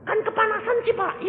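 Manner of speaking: high-pitched whine to the pastor; mock complaint. Dough-like convert complains of oven heat, high whine. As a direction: protest in a high voice.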